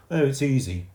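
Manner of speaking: put-on boastful know-it-all boy; Timothy. A narrator's reading. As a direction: brag to the younger boy.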